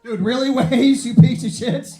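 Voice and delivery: high, loud, drawn-out indignation; outraged yelling. Acted. Driver cursing his navigation app, outraged. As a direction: yell at the app in comic outrage. Yells at the app that misled him.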